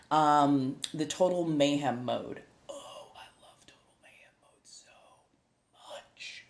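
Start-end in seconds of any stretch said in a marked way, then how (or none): 2.7-6.4 hushed, breath-only confession of love; gushing whisper. Real, not acted. Streamer confessing love of a game mode, whispering. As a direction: whisper it like a guilty pleasure.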